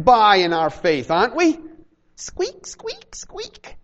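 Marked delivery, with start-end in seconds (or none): 2.1-3.8 tiny high squeaks fading to unvoiced; rat squeaks. Preacher mocking believers who squeak by, high rat squeaks. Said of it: "Like a bunch of rats".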